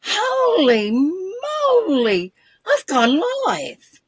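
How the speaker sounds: high, drawn-out shocked disbelief; stunned panic. Retold or imitated. Retelling her shock at going live on Facebook, high and stunned. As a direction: gasp it out like you just went live by accident. Realises she has gone live.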